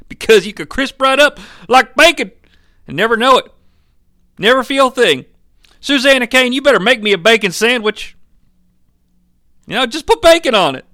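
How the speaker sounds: high, loud, carried-away dad; dad figure, ranting. Acted. Dad demanding a bacon sandwich from Susanna, high rant.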